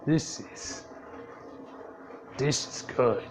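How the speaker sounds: hushed, pleased murmur over the new claw; quiet satisfaction. Acted. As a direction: breathe it out, admiring the new arm. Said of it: "He's like".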